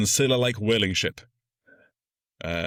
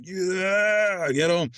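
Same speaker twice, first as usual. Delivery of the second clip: high, drawn-out mock cheer; imitated crowd cheering; 10 semitones higher, much slower than usual. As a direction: cheer like the whole crew. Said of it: "Everyone's cheering".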